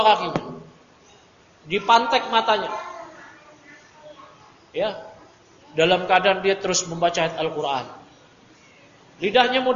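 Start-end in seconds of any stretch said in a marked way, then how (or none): none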